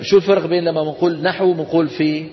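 normal talking voice

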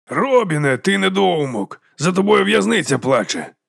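slow, high-pitched jeer; calling out. Acted. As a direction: call the insult across to him, stretched out. Calls out mock insult to boxer.

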